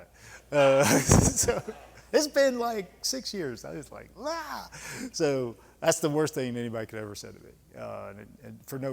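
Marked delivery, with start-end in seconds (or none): 4.2-4.7 a sudden high cry of frustration, short; frustrated yell. Retold or imitated. Remembering the hearing, a high frustrated cry. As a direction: let out a high exasperated "Ah!".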